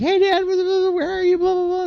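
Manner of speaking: put-on squeaky young boy on the phone; high kid voice. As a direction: chirp it like an eager kid. Kid answering phone to dad.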